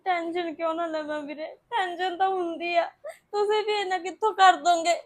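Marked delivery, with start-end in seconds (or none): 1.7-5.0 sobbing worry about the money; crying. Acted. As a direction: let the tears break into the words. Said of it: "ਭਾਬੀ ਰੋ ਕੇ ਕੀ ਹੋਊਗਾ".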